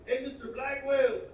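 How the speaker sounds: put-on a teen greeting the teacher in a Mexican accent. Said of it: "I love that real Mexican accent".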